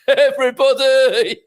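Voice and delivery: high, slow, loud shout; called-out greeting. Real, not acted. Host calling out to everybody, high, slow and loud. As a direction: hail the whole crowd.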